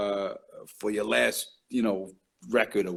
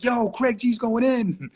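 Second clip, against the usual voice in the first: hype call; 12 semitones higher, 4 dB louder, slower than usual. Retold or imitated. Retold hype call from room.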